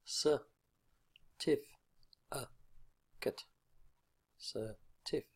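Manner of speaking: certificate broken into four separate beats; syllable by syllable. Shown as a demonstration. Teacher counting out the syllables, certificate in four separated beats. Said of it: "Four syllables".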